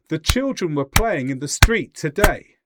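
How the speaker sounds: punchy, evenly spaced beats on "children", "playing", "street", "day"; "were" and "in the" squashed between strong beats; English stress rhythm. Shown as a demonstration. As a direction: hit "children", "playing", "street", "today" in steady time. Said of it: "equal amount of time between these stressed syllables".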